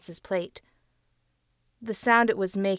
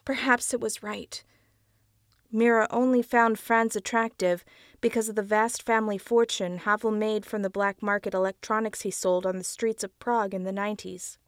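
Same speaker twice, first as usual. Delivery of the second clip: ordinary delivery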